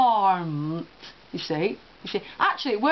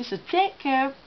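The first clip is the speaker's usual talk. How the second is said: normal talking voice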